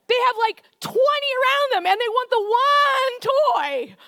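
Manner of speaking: animated. Acted. Child wanting sibling's toy.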